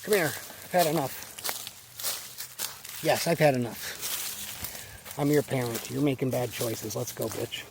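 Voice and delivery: a hint of a parent snapping at a kid; mock fed-up parent. Voices parents scolding their kid.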